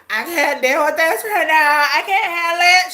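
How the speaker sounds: mocking Candace through laughter; laughing mock quote. Viewer mocks Candace's panic to her followers while laughing. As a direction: whine her line while cracking up. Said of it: "And she like".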